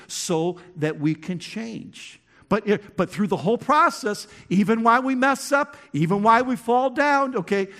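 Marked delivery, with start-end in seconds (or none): 2.5-7.7 higher and louder pulpit push; rally the room with rising force; preaching at full force; fiery preaching. Acted.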